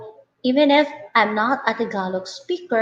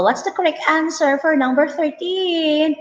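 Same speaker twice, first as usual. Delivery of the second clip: quiz question; 7 semitones higher than usual. Pretend. Teacher quizzing learners, mock question voice.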